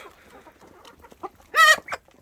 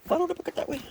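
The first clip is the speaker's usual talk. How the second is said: "Follow" sung very high, softer, falling at the end; high coaxing; 5 dB quieter, faster than usual. Real, not acted. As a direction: call the birds in a high, soft coaxing tone. Leading birds with bucket.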